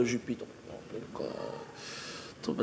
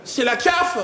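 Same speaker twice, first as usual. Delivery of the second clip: panicked cry; 4 dB louder, slower than usual. Retold or imitated. Panicked friend cries out.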